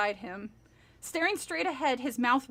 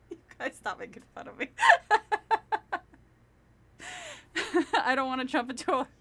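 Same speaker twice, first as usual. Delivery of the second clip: laughing; 3 semitones higher, much faster than usual. Real, not acted. Laughing fast at chat.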